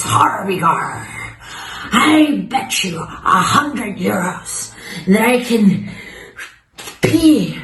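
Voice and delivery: high, hammed-up drunk pirate. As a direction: make a boastful bet as a tipsy pirate. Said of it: "He's really drunk".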